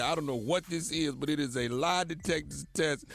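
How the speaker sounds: ordinary talk, nothing marked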